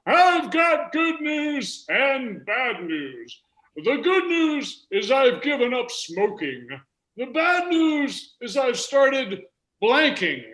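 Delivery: high, slow centenarian voice; very old man. Acted. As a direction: drag out the words in a high-pitched old man's voice. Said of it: "The hundred and two-year-old man said to his wife".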